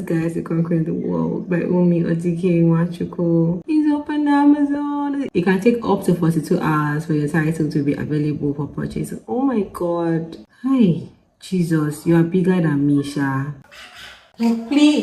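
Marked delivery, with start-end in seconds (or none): 3.7-5.3 excited high reading. Real, not acted. 14.4-15.0 excited disbelief. Real, not acted. Disbelief, repeating "like play.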